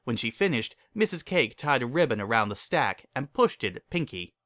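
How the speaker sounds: ordinary delivery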